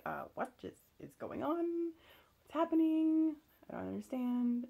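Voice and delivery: put-on confused sleepy boyfriend. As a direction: play him just woken and lost. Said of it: "I'm sure he was like".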